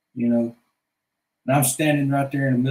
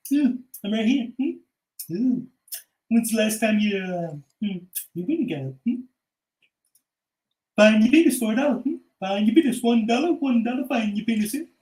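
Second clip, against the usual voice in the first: much higher, nasal-free sing-song huckster; high cartoon voice; 4 semitones higher than usual. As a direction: hawk it like a street vendor, high.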